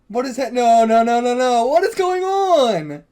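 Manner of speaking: loud, high burst of alarm; panicked outcry. Real, not acted. Panicked reaction to the plot twist.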